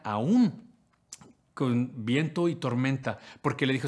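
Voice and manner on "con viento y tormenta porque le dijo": normal talking voice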